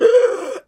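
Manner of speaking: high, loud sobbing gasp; hammed-up tearful intake; mock sob gasp. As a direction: gasp as if crying your eyes out. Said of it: "Sobbing my eyes out".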